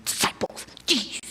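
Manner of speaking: breathy exasperated mutter under the breath; half-hearted annoyed mutter; mild annoyed grumble. Retold or imitated. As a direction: grumble it to yourself while going back to bed.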